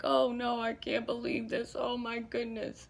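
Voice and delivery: a hint of a grieving father crying out; distraught king. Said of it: "really like".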